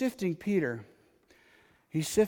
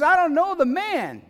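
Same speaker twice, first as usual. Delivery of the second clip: higher, louder acted denial stressing I; emphatic denial; 8 semitones higher, 6 dB louder than usual. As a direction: deny him hard, as if cornered. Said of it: "He swears an oath".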